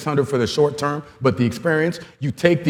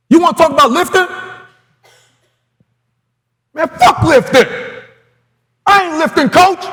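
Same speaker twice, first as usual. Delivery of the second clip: much higher, louder mocking kid voice; defiant player; 13 semitones higher, 4 dB louder than usual. Young player defying his coach, high cocky mocking voice.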